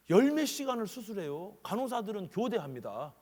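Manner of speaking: usual speaking voice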